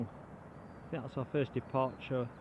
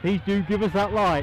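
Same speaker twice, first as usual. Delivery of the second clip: loud appeal; 5 semitones higher, 9 dB louder than usual. Real, not acted. Appealing loudly to viewers.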